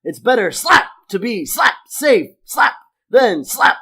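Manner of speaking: high, loud, punched word by word; slapping while talking. Acted. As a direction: punch out each word between voiced slaps. Voices slapping while Homer talks.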